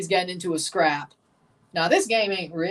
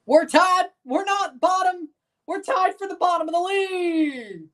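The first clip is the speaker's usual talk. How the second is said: excited shout; 12 semitones higher than usual. Real, not acted. Team ties for bottom, streamer yells.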